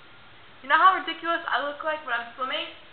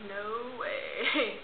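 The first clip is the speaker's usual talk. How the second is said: laughing refusal; 3 semitones lower, 8 dB quieter than usual. Retold or imitated. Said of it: "And I'm like".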